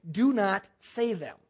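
slow, measured retold prohibition; deliberate command. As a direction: space the words out firmly, without raising volume.

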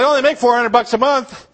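put-on a broke young soldier objecting to the pastor's challenge; incredulous protest. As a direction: squeak it out like that's impossible.

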